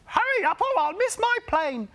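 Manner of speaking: high, urgent lady voice; hurried woman passenger. A narrator's reading. Woman in the taxi urging the driver, high and urgent. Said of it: "she told the taxi driver".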